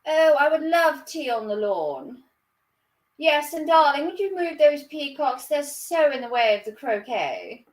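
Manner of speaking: posh put-on voice. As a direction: play a genteel lady fussing on the lawn. Mock upper-class garden party lines.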